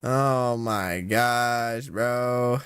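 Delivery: "Oh my gosh" stretched out very slowly, each word dragged; drawn-out exasperation. Real, not acted. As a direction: drag out "Oh my gosh" like you're at your limit. Overwhelmed by a hard pick.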